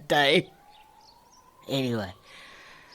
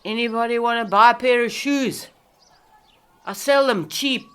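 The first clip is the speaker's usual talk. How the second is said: loud, higher street hawker's call; 8 semitones higher, 6 dB louder than usual. Acted. Woman calls out offering her shoes to anyone. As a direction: cry out your wares to the street.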